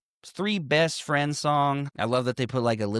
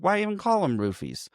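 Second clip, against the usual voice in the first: higher-pitched, insistent repeat; repeating more pointedly; 6 semitones higher than usual. Retold or imitated. Podcaster retelling a character asked what he said, higher pointed repeat. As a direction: lift the whole question as if asked to repeat it.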